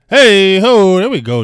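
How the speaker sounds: loud, high hype-man chant; calling out. Real, not acted. Streamer hailing a five dollar donation, loud high call.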